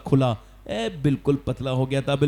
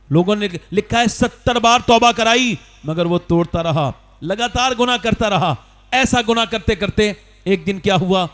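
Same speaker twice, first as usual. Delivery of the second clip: raised, pressing sermon delivery, higher and louder; fiery preaching; 4 semitones higher, 4 dB louder than usual. Acted. Preacher warning the faithful about bad friends, fiery preaching. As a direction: preach it hard at the crowd.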